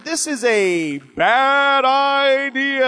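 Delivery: mock lecturing yourself, very slow; slow emphatic self-talk. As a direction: spell out "This is a bad idea" slowly to yourself. Acted self-talk spotting a bad idea.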